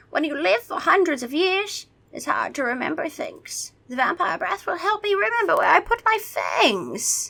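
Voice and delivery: put-on high, theatrical old vampire; high-pitched vampire voice; vampire impression. A narrator's reading. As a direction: explain as a forgetful ancient vampire. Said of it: "my vampire impression".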